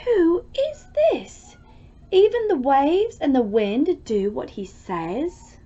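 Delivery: high, wondering voice; awed, frightened question. Acted. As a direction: gasp it out in amazement. Terrified disciples ask each other.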